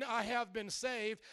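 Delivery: slow, measured, unshouted declaration; slow and restrained; not shouting. Acted. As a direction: say it slowly without raising your voice. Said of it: "I'm not shouting".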